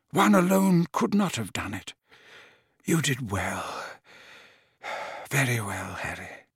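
put-on faint, slurring old man; words slurring with exhaustion; weak slurred voice. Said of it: "how slurred Dumbledore's voice had become".

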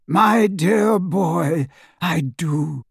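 put-on high, drawn-out old headmaster; Dumbledore. A narrator's reading. Headmaster answering his pupil in agreement, high and slow.

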